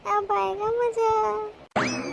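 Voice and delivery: soft, very slow scheming whisper-like aside; gleeful aside. Acted. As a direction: savour the trouble to come, slowly. Gleeful aside over music. (धीमे, मज़े लेते हुए चुपके से)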